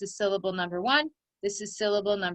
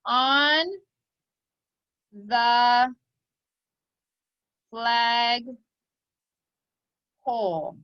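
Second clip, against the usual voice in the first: very slow, long pauses after every word; word-by-word dictation; 4 dB louder, much slower than usual. Shown as a demonstration. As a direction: dictate for children writing, each word isolated. Teacher dictating a sentence for writing.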